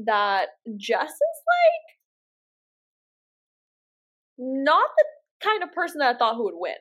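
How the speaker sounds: hesitant, careful phrasing. Real, not acted. Podcast host delicately doubts the winner, hesitant and careful. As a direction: draw up to it with long pauses, then let it out. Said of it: "why I'm saying it like that".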